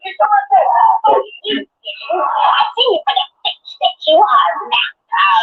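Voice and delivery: high, drawn-out angry shout; slow heated yell. Real, not acted. Player shouting slowly at a rival in the game, angry. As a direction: stretch every word out in a yell.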